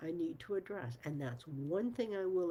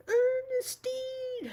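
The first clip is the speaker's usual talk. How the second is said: long drawn-out high yell of the name; shouted full name; 8 dB louder, much slower than usual. Retold or imitated. Teammate hollering her full name across the court, a long high yell. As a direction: call it across the court, stretched out slowly.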